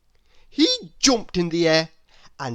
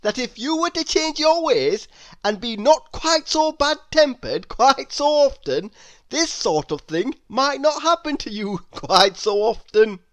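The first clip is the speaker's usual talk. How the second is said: much higher, louder, laughing through the words; 7 semitones higher, 5 dB louder than usual. Acted. Mister Happy laughing at Mister Grumpy's bad temper. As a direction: tease him through giggles. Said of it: "he laughed".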